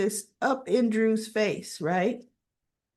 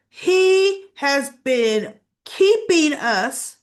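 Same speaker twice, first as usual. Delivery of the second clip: slow, loud and pointed; deliberate angry accusation; mock accusation; 5 semitones higher, 5 dB louder, much slower than usual. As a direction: spell out the charge slowly. Rocco accusing Drew.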